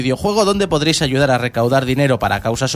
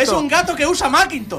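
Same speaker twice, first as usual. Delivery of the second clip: mocking high voice; 10 semitones higher than usual. Joking in a put-on voice.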